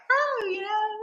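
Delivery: high, soft little chatter; high-pitched chat. Retold or imitated. Retelling a chat with the old lady, high-pitched chatter.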